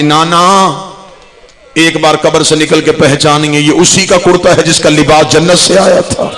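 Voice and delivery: mournful chanted recitation of the lament; majlis recitation. Acted. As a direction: draw out each line like a grieving reciter, rising bit by bit. (मातमी मजलिस पढ़ने का अंदाज़)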